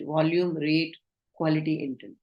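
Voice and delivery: usual speaking voice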